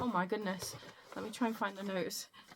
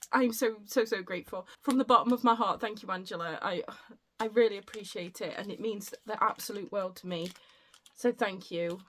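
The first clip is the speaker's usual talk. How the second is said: ordinary talk, nothing marked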